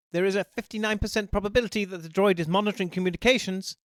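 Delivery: high, fast machine voice; robot dog voice. Acted. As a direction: rattle off figures like a robot. K9 reports probability.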